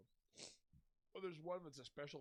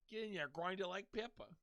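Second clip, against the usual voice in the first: louder, raised cartoon impression; Murder Face; 4 semitones higher, 6 dB louder than usual. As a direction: push it out as a cartoon character. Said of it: "That's like Murder Face".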